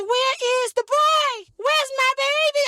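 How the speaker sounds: wife calls out in alarm; frantic wife. Retold or imitated. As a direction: demand where the baby is. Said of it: "She said".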